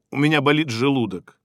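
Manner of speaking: ordinary delivery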